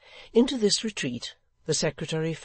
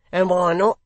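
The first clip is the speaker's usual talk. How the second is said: high, loud, surly retort; 6 semitones higher, 12 dB louder than usual. A narrator's reading. Hugh overhearing the remark, surly. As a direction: throw it out loudly, surly. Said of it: "in a surly voice".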